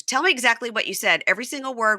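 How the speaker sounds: high, pushy demanding wife. Retold or imitated. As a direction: press him for every word.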